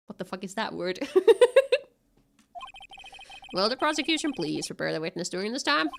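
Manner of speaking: ordinary talk, nothing marked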